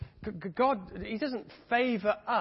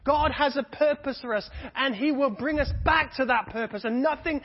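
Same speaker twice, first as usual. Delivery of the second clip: loud, higher, climbing sermon fervour; preaching at full force; 6 semitones higher, 7 dB louder than usual. Acted. Preacher rousing the congregation to never give up, loud and soaring. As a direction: preach it out to the whole church.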